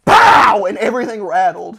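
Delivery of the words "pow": loud pow explosion sound; imitated blast. Narrator imitating the firecracker blast, loud pow. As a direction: punch out the bang. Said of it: "Instead, it was like".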